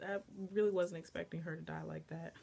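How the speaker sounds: ordinary talk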